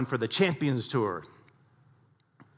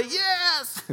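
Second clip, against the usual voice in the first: high eager yes. Retold or imitated. Golfers answer.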